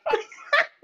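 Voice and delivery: high, loud laugh bursting out; sudden high laugh before a plea; burst of laughter. Real, not acted. Laughs at own story.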